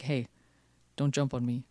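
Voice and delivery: low, quiet mock threat; menacing enemy. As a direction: play a little tough guy warning someone off, low and soft. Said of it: "look at the menacing expression on this guy".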